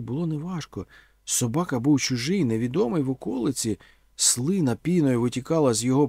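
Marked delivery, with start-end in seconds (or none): none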